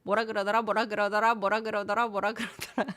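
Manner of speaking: rapid-fire repetitions run together without a break; quick tongue twister. Shown as a demonstration. Teacher shows students a tongue twister, said quickly. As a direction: rattle it off in quick repeats. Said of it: "ten times quickly".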